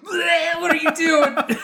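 high loud retching question, laughing; gagging girlfriend. Retold or imitated. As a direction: mimic her walking in again disgusted. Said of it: "I came in and was like".

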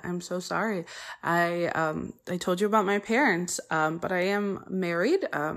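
ordinary delivery

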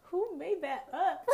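high, drawn-out words through real laughter; helpless giggling at your own joke; laughing. Real, not acted. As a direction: crack up while asking. Cracking up at her own saying.

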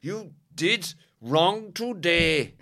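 slow, high, loud scolding; stern priest. Retold or imitated. Canon scolding the altar boy after mass, mock stern. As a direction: drag it out like a disapproving canon. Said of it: "he was like".

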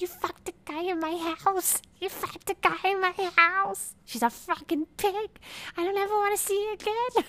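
extremely high, loud mock screech; hammed-up hysterical rage at a squeaky pitch; weird octave. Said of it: "her voice gets this, like, weird octave".

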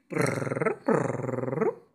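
soft rolled purr; cat purring. Retold or imitated. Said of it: "ruidito muy gracioso".

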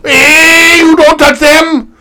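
loud, high mock Montreal Canadian accent; comic Montreal accent, building to a high yell. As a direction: shout it in a hammed-up Montreal French-Canadian accent. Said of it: "Montreal Canadian".

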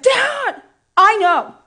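high loud outburst at dad; retold yell. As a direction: yell it at him, frustrated. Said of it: "yelled at my dad".

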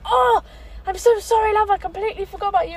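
high-pitched, loud mock apology from the driver; mock bus driver. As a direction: squeak out the driver's fluster, with "love" at the end. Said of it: "She went".

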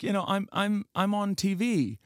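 retold Jimmy Fallon. Celebrity explaining his fame to the aunt, acted hesitancy. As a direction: imitate a celebrity gently explaining himself. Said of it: "And he's like".

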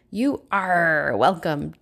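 put-on slow, lightly gruff kid pirate. A narrator's reading. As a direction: put on your best pirate voice. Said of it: "Dora said in her best pirate voice".

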